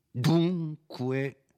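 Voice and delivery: high, loud and commanding; forceful. A narrator's reading. Renzo demands the name from the priest, forcefully. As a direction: bark the word out with full force. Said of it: "proferito con una tale energia".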